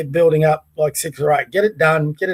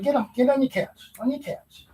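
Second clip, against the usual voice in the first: much softer, hushed order to the dog; soft firm command; 6 semitones higher, 8 dB quieter than usual. Real, not acted. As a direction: quietly insist the dog go back. Quietly ordering dog back to couch.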